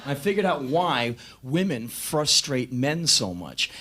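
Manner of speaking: ordinary delivery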